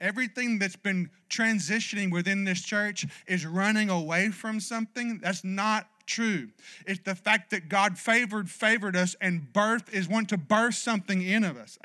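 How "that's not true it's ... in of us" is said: normal talking voice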